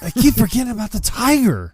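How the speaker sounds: high, loud fretting whine; hammed-up panic; panicked whine. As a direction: squeal it like you've lost it. Imitating Phil's panic.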